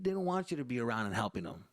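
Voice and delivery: ordinary talk, nothing marked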